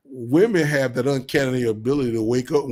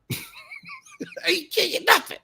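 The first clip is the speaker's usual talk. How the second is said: high, slow mocking sing-song; slower than usual. Mocking a stingy man by refusing a woman gifts, in a high sing-song. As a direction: stretch it out in a high teasing voice.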